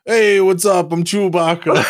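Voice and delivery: loud, high-pitched silly impression; Chewbacca. Amateur voice actor showing off a best impression, loud bad impersonation. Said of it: "my best, like, Chewbacca impression".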